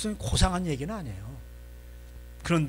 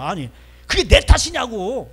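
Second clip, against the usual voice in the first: loud, high, drawn-out protest; angry outburst; 4 semitones higher, 9 dB louder than usual. Acted. Jacob refusing blame from his wife, slow loud angry protest. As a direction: protest the blame, stretching it out loudly. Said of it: "막 성질을 팍팍 내는 거야".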